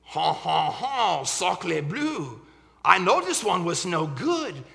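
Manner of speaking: put-on loud, snooty French accent; a yelping French poodle, laughing and sneering. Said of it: "began yelping".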